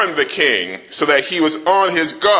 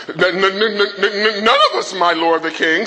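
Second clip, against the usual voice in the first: stammering officer; 3 semitones higher than usual. Acted. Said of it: "said one of his officers".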